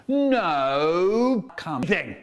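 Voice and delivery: very slow, drawn-out teacher's command; strict teacher. Retold or imitated. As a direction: stretch the order out like a fearsome teacher. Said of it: "teacher who was so strict".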